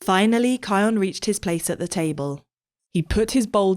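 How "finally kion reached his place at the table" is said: ordinary delivery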